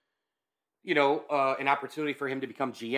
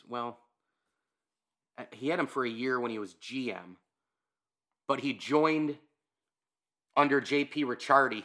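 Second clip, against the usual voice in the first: ordinary talk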